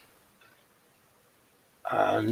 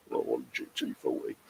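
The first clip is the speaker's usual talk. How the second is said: breathy whispered grumble, much softer; mock moaning mutter; 14 semitones lower, 15 dB quieter than usual. Moaning to Dave all afternoon about a kit, muttered. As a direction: sulk it under your breath. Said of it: "I'm gonna be moaning all afternoon".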